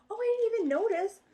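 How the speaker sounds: high sudden surprise; surprised. Real, not acted. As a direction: catch yourself, surprised. Noticing colored pages.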